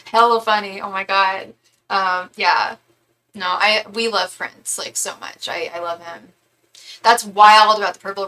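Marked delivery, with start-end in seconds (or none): none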